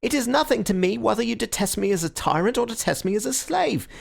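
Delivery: high, exalted voice beyond pain; martyr in ecstasy. A narrator's reading. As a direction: declare it high, like a suffering martyr. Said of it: "in a voice like that of a martyr".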